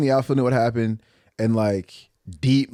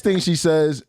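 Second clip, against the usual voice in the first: usual speaking voice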